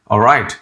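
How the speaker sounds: "All right" said smoothly as "aw-rite"; no trill in "All right", just "aw-rite"; soft R. Shown as a demonstration. Teacher repeating the better way, soft "All right". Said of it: "A W R I T E".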